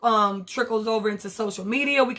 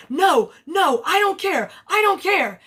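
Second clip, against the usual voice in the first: mock Candace; 6 semitones higher than usual. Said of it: "Candace was like".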